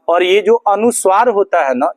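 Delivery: ordinary delivery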